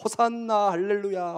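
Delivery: mildly acted jubilant acclamation; crowd's excited cheer. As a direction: imitate a welcoming crowd's praise. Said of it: "신나가지고".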